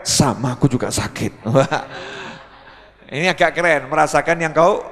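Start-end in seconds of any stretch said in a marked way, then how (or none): 0.1-1.4 lightly voiced husband, lower pitch; slightly put-on cool husband; husband feigning cool. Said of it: "suaminya pura-pura rodok keren". (suami pura-pura keren)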